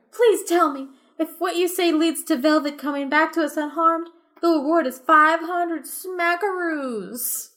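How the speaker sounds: high, pleading woman's voice; cat owner promising the caller a reward; eager cat owner. Acted. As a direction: dangle the reward with hope.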